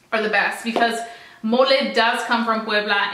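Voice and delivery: usual speaking voice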